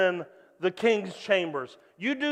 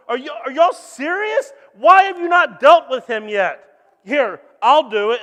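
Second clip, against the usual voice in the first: higher, louder, incredulous challenge; David can't believe nobody fought, loud; indignant outburst; 5 semitones higher, 10 dB louder than usual. Acted. David confronts the soldiers, indignant and loud.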